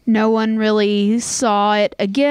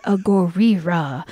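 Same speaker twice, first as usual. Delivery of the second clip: slow, lower, mock Japanese accent; botched monster-film Japanese accent, drawn out; bad Japanese accent; 4 semitones lower, slower than usual. Host imitates kaiju films for quiz option, fake Japanese accent. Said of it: "trying to do Japanese and".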